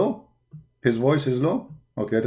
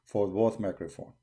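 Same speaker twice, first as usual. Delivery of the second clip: much softer, dropping the volume to match the other mic; turned-down voice for a level check; lowered voice; 3 semitones lower, 12 dB quieter than usual. Real, not acted. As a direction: speak quietly to balance the microphones. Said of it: "I will lower my voice".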